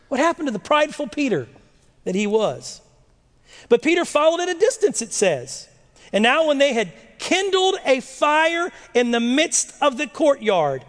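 normal talking voice

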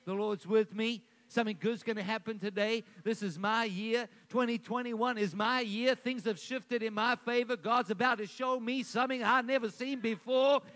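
fast, rising, confident morning confession; upbeat declaration. Shown as a demonstration. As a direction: speak it out with eager certainty. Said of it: "you should declare".

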